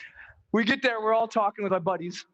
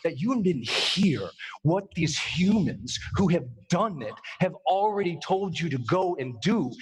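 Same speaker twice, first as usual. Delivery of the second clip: ordinary delivery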